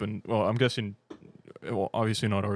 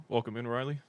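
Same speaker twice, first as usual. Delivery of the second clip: normal talking voice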